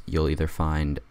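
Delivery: ordinary talk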